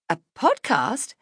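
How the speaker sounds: slow, high disbelief; incredulous. A narrator's reading. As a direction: repeat it as if absurd. Incredulous question.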